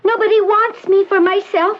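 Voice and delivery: put-on high, small voice of a withdrawn young woman; frightened girl. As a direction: push help away like a scared child. Patient laments.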